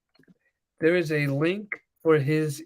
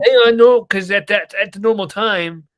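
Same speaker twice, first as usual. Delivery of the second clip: raised voice building to loud, high protest; yelling; 5 semitones higher, 8 dB louder than usual. Real, not acted. Arguing with co-host about recording at normal time, yelling. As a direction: get louder and higher, arguing back. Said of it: "now you got me yelling".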